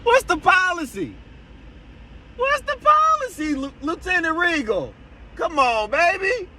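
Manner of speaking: high, straining yell across the lot; shouting after him. Real, not acted. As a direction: call after him, pitch climbing. Yelling after departing lieutenant.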